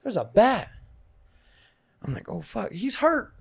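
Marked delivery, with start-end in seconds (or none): 0.0-0.7 put-on little kid. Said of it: "You know, I'm a little kid". 2.3-3.3 put-on worried little kid. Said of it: "You know, I'm a little kid".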